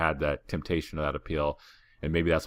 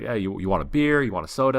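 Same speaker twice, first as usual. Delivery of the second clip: higher, louder hawker pushing drinks; pushy seller offer; 6 semitones higher, 5 dB louder than usual. Retold or imitated. Old seller with a backpack pushing beer and soda, insistent. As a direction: keep pushing the offer brightly.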